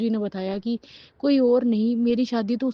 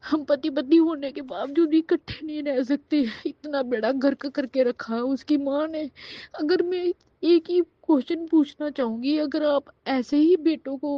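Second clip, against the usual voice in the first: higher, crying as she speaks; real tearful anguish; 4 semitones higher than usual. Real, not acted. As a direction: push the grievance out through tears. Breaking down over broken marriage. (रोते हुए ऊँचे स्वर में शिकायत)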